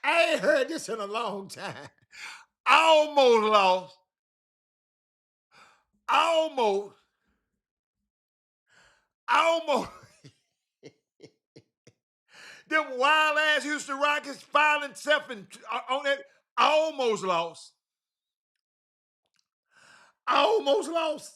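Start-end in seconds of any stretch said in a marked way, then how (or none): 2.7-9.9 high, slow, stretched mockery; mocking repetition. Real, not acted. 16.6-21.3 mocking repetition. Real, not acted.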